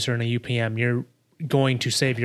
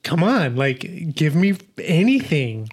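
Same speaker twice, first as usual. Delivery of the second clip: acted exasperation, pitched up; exasperated pleading; 4 semitones higher, 4 dB louder, slower than usual. Editor begging the movie for a cut, mock exasperated. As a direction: plead in mock frustration at the screen.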